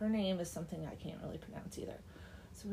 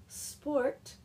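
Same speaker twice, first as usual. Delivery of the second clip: "sport" set apart, pitched up and louder; stress on sport; 7 semitones higher, 5 dB louder than usual. A narrator's reading. Deacon proposes a little sport, "sport" heavily stressed. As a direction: lean hard on "sport" as if italicised. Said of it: "sport was in italics".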